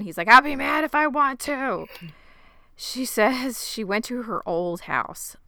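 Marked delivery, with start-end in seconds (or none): 0.3-1.9 higher and louder, a mock outburst; angry Martin. Martin refuses to be told not to be mad, mock angry. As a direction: voice the controlling man losing his temper.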